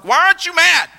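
a sudden hammed-up cry of disbelief; exasperated mock outburst. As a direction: throw the question at them in mock frustration. Mocking exasperated joyful people.

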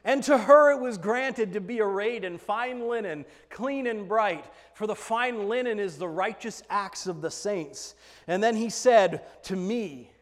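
ordinary talk

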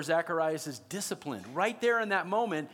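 ordinary talk, nothing marked

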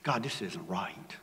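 whispered in dismay; stunned whisper to God; hushed whisper. Retold or imitated. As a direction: breathe it out, barely voiced. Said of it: "I was like".

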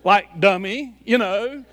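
mock teasing. Teasing what wife implied.

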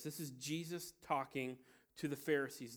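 usual speaking voice

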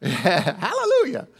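ordinary delivery

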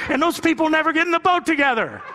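cracking up at the punchline; giggling delivery; laughing through it. Real, not acted. Retelling a joke, laughing.

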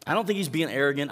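ordinary talk

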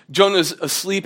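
normal talking voice

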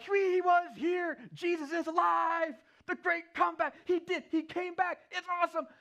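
high, loud, stretched-out gushing; hammed-up overjoyed shouting, voice way up; ecstatic. Disciples telling Thomas he missed Jesus, ecstatic.

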